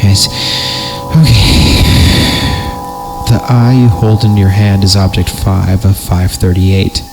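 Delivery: ordinary talk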